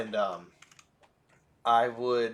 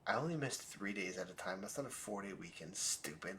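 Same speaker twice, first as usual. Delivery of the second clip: inner thought; 11 dB quieter than usual. Retold or imitated. Student in the hallway thinking to himself, hushed. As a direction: say it quietly to yourself. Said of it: "in my head I went".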